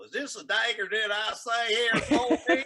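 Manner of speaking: high, loud theatrical Southern drawl. Shown as a demonstration. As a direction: declaim Shakespeare in a broad Southern drawl. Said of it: "Now, you say it in a Southern drawl".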